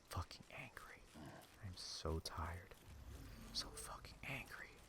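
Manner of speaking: real exhausted, seething near-whisper; tired and angry. Real, not acted. Venting about being so tired and angry, exhausted whisper. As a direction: barely voice it, worn out and seething. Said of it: "I am so fucking tired".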